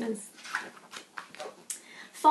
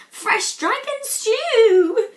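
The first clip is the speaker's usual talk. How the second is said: loud, high and slow "fresh dragon stew"; cried out; 8 semitones higher, 8 dB louder, much slower than usual. Acted. Vikings crying out at the dragon, loud and slow. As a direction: yell it greedily. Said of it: "cried the Vikings".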